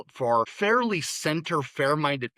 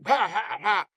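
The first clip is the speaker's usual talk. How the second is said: mock braying laugh, pitched higher; donkey laugh; 6 semitones higher than usual. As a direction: bray it out like a donkey, lightly imitated. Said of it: "donkey laugh".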